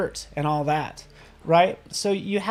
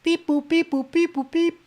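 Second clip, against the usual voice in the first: high-pitched robotic beep-boops; mock scanning noises in a high voice; computer beeps imitation; 10 semitones higher than usual. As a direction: play the computer busily thinking, high up. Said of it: "So it's analyzing. It's like".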